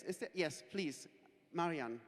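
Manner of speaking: ordinary talk, nothing marked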